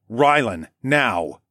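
short, firm fatherly order; stern. A narrator's reading. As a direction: leave no room for argument. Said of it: "I said sternly".

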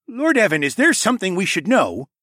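taken aback, voice lifted; wide-eyed question. A narrator's reading. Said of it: "asked with wide eyes".

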